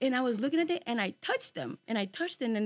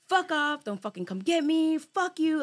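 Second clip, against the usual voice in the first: higher, louder cursing at it; angry retort; 6 semitones higher, 6 dB louder, slower than usual. Retold or imitated. Telling the presence to leave him alone, angry. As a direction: tell the thing off hard. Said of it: "I was, like, angry".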